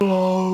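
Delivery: sharp, loud, high gasped oh; retold gasp. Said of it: "I fucking gasped".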